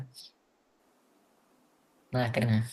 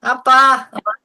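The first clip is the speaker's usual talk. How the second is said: high startled yell; loud confused shout; 9 semitones higher, 13 dB louder than usual. Real, not acted. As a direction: bark it out, bewildered. Kid confused in the game.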